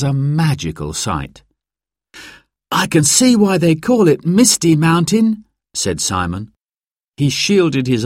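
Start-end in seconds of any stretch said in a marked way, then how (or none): 2.7-5.4 put-on high-pitched young boy; child's voice. Simon looking up at the mountain, child's high voice. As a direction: speak up high like an impressed child.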